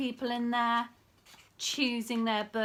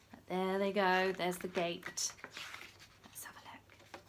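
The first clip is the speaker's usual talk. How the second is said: whisper; 6 semitones lower, 5 dB quieter than usual. Acted.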